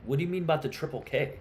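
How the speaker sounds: ordinary delivery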